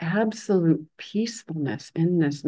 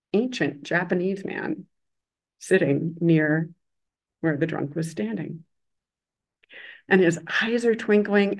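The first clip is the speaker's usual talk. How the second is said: ordinary delivery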